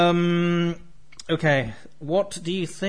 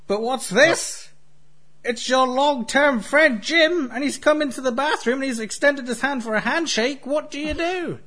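game show host voice; 8 semitones higher than usual. Acted. Host sets up the scenario.

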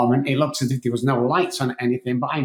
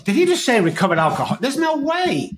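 higher, stunned disbelief at what he heard; 10 semitones higher than usual. Retold or imitated. A newcomer hears a man call himself recovered, stunned disbelief. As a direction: gasp it out as if it can't be true. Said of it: "And I was like".

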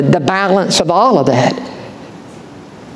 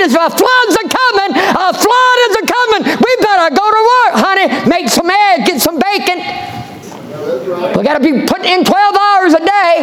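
Daddy rallying the family. Retold or imitated. Noah rallying his wife and sons to work, hearty shout. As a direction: shout the orders cheerfully. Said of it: "and Daddy said".

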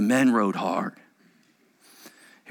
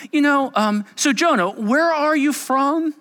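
higher, hesitant small-talk voice; mock-casual chat, pitched up; mock casual questioning; 12 semitones higher, 5 dB louder than usual. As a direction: imitate a polite, halting interviewer. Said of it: "just in case you thought this is how the story goes".

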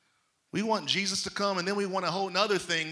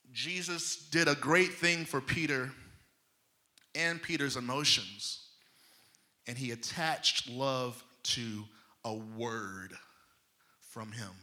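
ordinary talk, nothing marked

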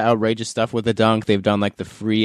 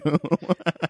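quick high laugh; 7 semitones higher, 4 dB quieter than usual. Real, not acted. Laughs at joke.

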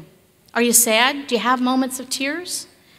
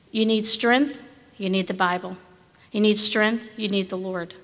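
normal talking voice

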